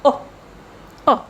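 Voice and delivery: O as a brief, cut-short schwa "uh"; short vowel. Shown as a demonstration. Teacher showing schwa sound, short vowel. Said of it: "the sound is short".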